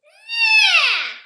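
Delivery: shrieked very high loud nya; horrible nya. Shown as a demonstration. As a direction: make nya as horrible as you can. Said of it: "The more horrible it is".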